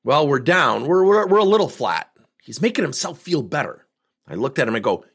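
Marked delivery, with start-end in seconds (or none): 0.0-2.1 lightly voiced hedging executive, louder and higher; a hint of a squirming VP softening bad news; sugarcoating admission. VP admits his sales are down, lightly mock hedging. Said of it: "putting a little extra sauce on it".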